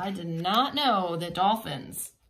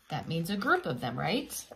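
plain reading voice

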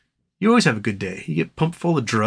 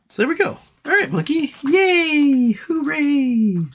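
much higher, bright cheering at the dog; excited pet talk; slower than usual. Real, not acted. Calling the dog Monkey over, high excited pet talk. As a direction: cheer the dog on like a squealing owner.

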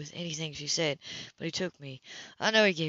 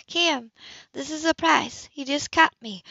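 higher, cool woman's voice, caught off guard; higher, cooler woman's voice to Cam at her door; Anna's voice; 9 semitones higher, 5 dB louder than usual. Acted. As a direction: play the composed woman surprised at her door. Anna surprised at door.

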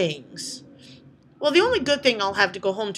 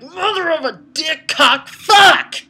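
much higher and louder, a hammed-up yell building to a shriek; mock furious outburst; 8 semitones higher, 10 dB louder, slower than usual. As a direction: explode in theatrical rage. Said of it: "I was mad".

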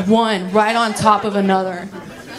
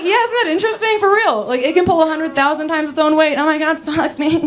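high, breezy over-eager enthusiasm; gushing excitement; 7 semitones higher than usual. Acted. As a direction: gush on, carried away. Keeps gushing trivia during sex.

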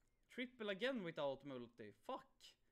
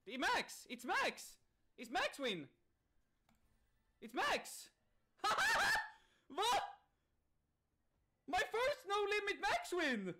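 shrieking jackpot winner; 8 dB louder than usual. Real, not acted. Hits the max win.

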